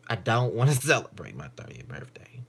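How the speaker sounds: whispered with a laugh in it. Real, not acted. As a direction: whisper it like a giggly secret. Whispered complaint.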